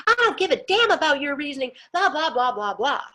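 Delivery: high-pitched mocking rant; dismissive mock tirade; imitated yelling. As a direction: mimic an irate landlord ranting, easing off at the end. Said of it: "if you're not going to yell".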